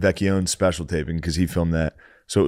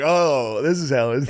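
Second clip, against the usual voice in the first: mocking tease; 6 semitones higher than usual. Nate mocking, pitched up.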